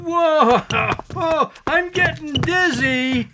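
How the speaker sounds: high, startled and dizzy; surprised whoa going dizzy. Acted. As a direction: reel off balance with a yelp. Man spun in a pirouette.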